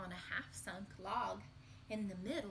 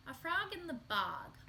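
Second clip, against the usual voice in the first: storybook title; 6 semitones higher, 3 dB louder than usual. Acted. Reading the title to kids.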